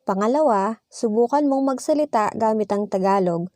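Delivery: usual speaking voice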